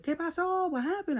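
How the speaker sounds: very high-pitched imitation of a fretting mother; nervous, hysterical mom. Mom finding the child hiding an injury, imitated hysterics. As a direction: shoot the pitch way up, mimicking mom's alarm. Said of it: "she gets very, um, nervous".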